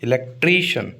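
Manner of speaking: Electrician with a long, weighted tree; stress on tree. Shown as a demonstration. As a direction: lean on TREE in electrician. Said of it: "TRI पे stress आएगा". (Electrician के tree पर ज़ोर)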